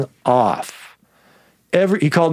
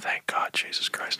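whispered thanks, softer; relieved whisper; 13 dB quieter than usual. Retold or imitated. Teller whispering his relief as the girl steps in. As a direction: breathe it out in relief. Relieved aside while in danger.

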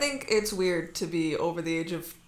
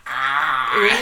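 Boston accent; 6 semitones lower, 7 dB louder than usual. Shown as a demonstration. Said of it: "We drop our R's".

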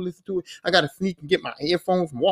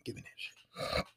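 whisper; 20 dB quieter than usual. Retold or imitated. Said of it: "I'm just like".

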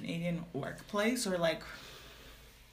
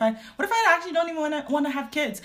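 excited; 9 semitones higher, 7 dB louder, faster than usual. Real, not acted. Worked-up rhetorical question.

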